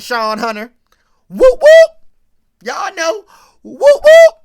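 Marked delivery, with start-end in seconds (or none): 1.3-1.9 high, loud whooping siren sound; police siren imitation. Mimicking the police siren as the cops pull up on the drunk teens. 3.6-4.3 high, loud whooping siren sound; police siren imitation. Mimicking the police siren as the cops pull up on the drunk teens.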